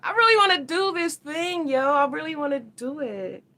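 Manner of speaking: high, eager voice; excited high voice. Real, not acted. Vlogger telling viewers her eager wish in a high voice.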